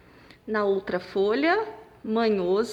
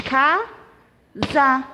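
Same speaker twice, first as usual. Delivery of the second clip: casa in two separate beats, ca, sa; two punched syllables on casa; split into syllables; 2 semitones higher, 4 dB louder than usual. Shown as a demonstration. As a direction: clap out casa with a gap between syllables. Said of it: "Bati duas vezes".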